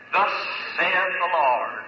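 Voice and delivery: slow, weighty proclamation; slow solemn declaration of the Lord's word; solemn prophecy. Retold or imitated. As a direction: pronounce it like a divine decree, drawn out. Prophet declaring healing over dying boy.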